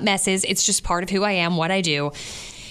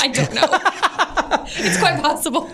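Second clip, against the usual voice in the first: giggling through it; amused, laughing answer; 6 semitones higher than usual. Real, not acted. Joke about going through the change.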